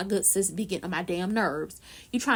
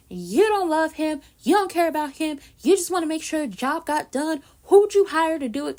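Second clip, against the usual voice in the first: loud, pressing accusation; accusing interrogation; 5 semitones higher, 5 dB louder than usual. Retold or imitated. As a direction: grill her like a suspect. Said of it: "he's very much giving".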